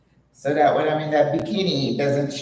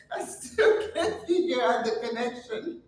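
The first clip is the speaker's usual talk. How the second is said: laughing; 7 semitones higher, 4 dB quieter than usual. Real, not acted. Laughing at the joke.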